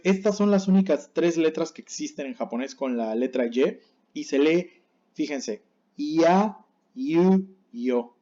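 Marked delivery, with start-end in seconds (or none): 6.0-8.2 "ya, yu, yo" gliding from an i: ia, iu, io; spaced syllables "ya, yu, yo", soft i glide; soft Japanese Y. Shown as a demonstration. Teacher models Y syllables for learners, "ya, yu, yo" soft as i. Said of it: "La Y se pronuncia muy suave".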